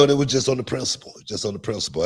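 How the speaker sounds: normal talking voice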